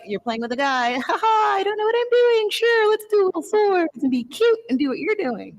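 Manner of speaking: high, giggly, ditzy sing-song; squeaky mock-innocent teasing voice; mock girly voice. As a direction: play dumb and cute, way up high. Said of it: "something in the manual that was like".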